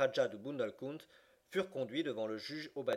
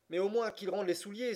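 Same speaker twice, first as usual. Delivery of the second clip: high-pitched flash of rage; indignant cry; 7 semitones higher than usual. A narrator's reading. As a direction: protest crossly, voice pitched up. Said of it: "s'écria Passepartout avec un mouvement de rage". (protestation rageuse, voix aiguë)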